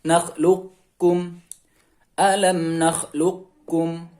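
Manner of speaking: "nakhluqkum" recited slowly, q passing directly to k; qaf straight into kaf. Shown as a demonstration. Teacher models moving directly to kaf, slow unechoed recitation. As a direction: draw out "nakhluqkum" with no echo after q. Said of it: "tapi langsung ke huruf kaf".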